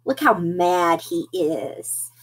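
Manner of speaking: usual speaking voice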